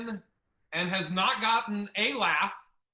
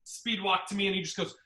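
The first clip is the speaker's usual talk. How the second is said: normal talking voice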